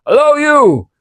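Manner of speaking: high, loud hail into the mist. Acted. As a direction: call out to the approaching rider. Guard hails the rider.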